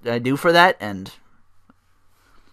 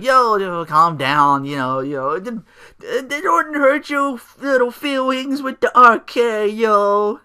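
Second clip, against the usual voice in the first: much higher, slow taunting sneer; mocking Rollins; 10 semitones higher, 6 dB louder, slower than usual. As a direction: belittle him like a child. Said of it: "Rollins is like".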